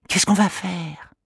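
put-on high child voice, hushed; a boy whispering a worry; worried little boy. Manu asking his sister what to do, worried boy voice. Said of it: "dit Manu".

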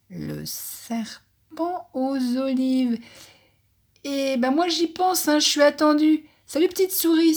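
high hurried snake voice, excuses tumbling out; hasty hissing snake. A narrator's reading. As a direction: hiss a hasty goodbye and flee. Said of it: "siffle le serpent avec empressement".

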